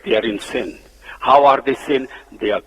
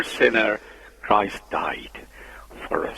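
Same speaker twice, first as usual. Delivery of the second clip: whispered verse; 7 semitones lower, 9 dB quieter than usual. Shown as a demonstration. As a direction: drop to a hushed whisper on Christ died. Same verse repeated, whispered.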